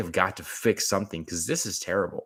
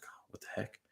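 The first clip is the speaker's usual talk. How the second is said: mock whisper; 18 dB quieter than usual. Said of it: "He's like".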